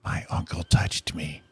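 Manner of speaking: whispered like a secret-telling doll; breathy toy whisper, deadpan; creepy doll whisper. Pretend.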